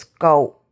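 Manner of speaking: "go" said low, with a falling tone; tone demo. Shown as a demonstration. Teaching how "go" sounds, low tone. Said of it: "a different tone to it".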